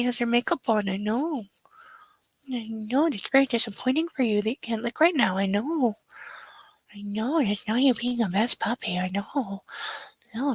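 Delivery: tender owner cooing to her puppy. Real, not acted. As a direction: lull a sleepy puppy back to rest. Baby talk to sleepy puppy.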